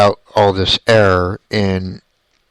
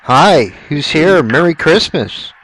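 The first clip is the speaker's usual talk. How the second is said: calling out; 7 semitones higher, 3 dB louder than usual. Real, not acted. Calling out to spirits on session.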